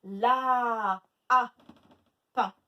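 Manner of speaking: LA held long and loud, the A repeated, then short pain; stretched first syllable. Shown as a demonstration. Teacher isolating A in lapin, stretched syllables. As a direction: draw out La, echo a, separate pain. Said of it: "Où est-ce qu'on entend le A?".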